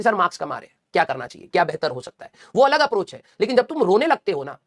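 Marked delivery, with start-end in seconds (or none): 0.0-2.3 low, calm, sensible question to the teacher; polite student asking. Shown as a demonstration. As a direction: ask it humbly, not whining. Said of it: "definitely बोल कि".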